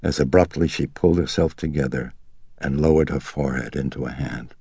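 ordinary delivery, nothing marked

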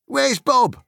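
high, loud landlord's question; brisk demand. A narrator's reading. Landlord asking after the stable hand, loud and brisk. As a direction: demand an answer from the servant. Landlord quizzing his servant.

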